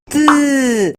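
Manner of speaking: "T" as a short voiceless tongue tap with no vowel; only the tap of "T" behind the teeth; bare T sound. Shown as a demonstration. Teacher leading the class in the bare T sound. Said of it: "Vamos, niños".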